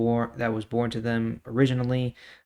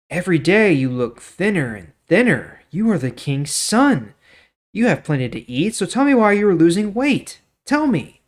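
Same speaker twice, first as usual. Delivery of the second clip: clever friend prodding; 7 semitones higher, 9 dB louder than usual. Acted. Jonadab questions Amnon.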